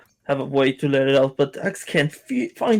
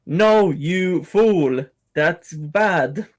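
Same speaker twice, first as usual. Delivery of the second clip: imagined Azora scolding; 2 semitones higher, much slower than usual. Acted. [name] imagines a friend's answer, slow mock scolding. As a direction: scold like a drawn-out conscience on the shoulder. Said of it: "What would Azora say?".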